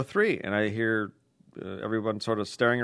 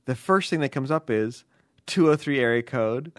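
ordinary talk, nothing marked

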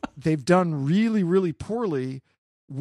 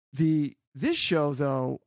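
ordinary talk, nothing marked

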